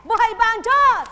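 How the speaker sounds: very loud, high preacher's cry; rallying shout. Real, not acted. Preacher rousing the congregation with a loud cry. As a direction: shout it to the rafters.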